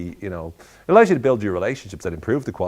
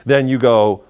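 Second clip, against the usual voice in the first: ordinary delivery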